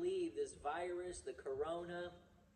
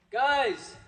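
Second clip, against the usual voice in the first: loud call; 8 semitones higher, 14 dB louder than usual. Real, not acted. Calling the others to start.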